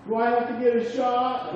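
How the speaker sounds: put-on high, pleading child's voice; worried little boy. Son asks dad in the car, high anxious child voice. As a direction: whine it like a scared five-year-old.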